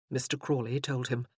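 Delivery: normal talking voice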